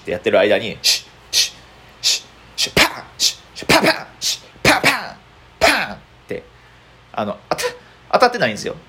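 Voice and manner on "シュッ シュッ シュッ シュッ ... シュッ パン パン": rapid hisses that jump high into sharp pan impacts; mouth punch sounds. Retold or imitated. Boxer faking hits with his mouth, rapid high pan sounds. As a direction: fire off fake hit noises faster and faster. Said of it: "打撃音を口で出す".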